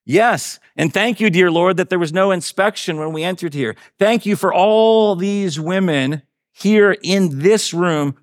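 high, fervent prayer, slowing and drawn out toward the end; fervent thanksgiving prayer. A narrator's reading. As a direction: pray your thanks aloud, stretching the final phrases. Betsy prays thanks aloud in story.

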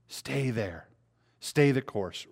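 usual speaking voice

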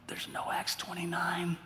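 slow, hushed whisper to himself. Retold or imitated. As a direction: breathe it like a private aside. And I went.

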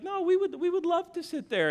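high-pitched imitation of an eager wife. Wife accepts the bar table from hostess, eager. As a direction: pitch it up, sweetly insisting.

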